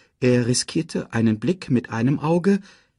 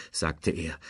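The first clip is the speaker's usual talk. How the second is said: ordinary delivery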